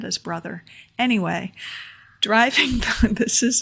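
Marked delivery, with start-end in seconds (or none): 2.2-3.6 higher, louder, breaking into laughter; laughing. Real, not acted. Speaker resuming after kid's yelling, laughing. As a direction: try to resume through a laugh.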